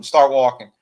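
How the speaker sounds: loud, hard command; gunpoint order. Retold or imitated. Deputy marching them off at gunpoint, loud command.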